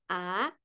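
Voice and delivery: "a" rising softly; second-tone demo. Shown as a demonstration. Teacher running through four tones, rising "a". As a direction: lift the end of "a". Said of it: "Berarti kalau kita simpulkan".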